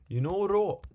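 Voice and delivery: a sudden high, loud, botched put-on voice; botched accent attempt. Streamer's failed first go at a voice-actor accent, high and loud. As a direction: fling out a first try at an accent and miss it. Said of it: "Oh my god, that was terrible".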